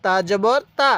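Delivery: ordinary delivery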